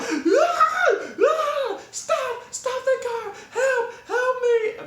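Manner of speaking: very high, loud mock screams for help; Ryan's screaming. Storyteller imitating friend hanging from the car, high screaming. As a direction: shriek frantically, half laughing. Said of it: "Ryan is screaming".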